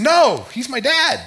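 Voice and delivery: put-on high, slow, loud childlike voice; child's answer. Pastor imitating his child's reply to a friend, high and emphatic. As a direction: say it like a proud, insistent kid.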